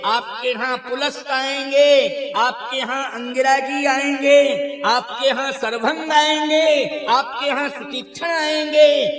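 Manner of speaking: rising rhetorical crescendo; building excitement. Acted. Acted list of visitors, steadily building.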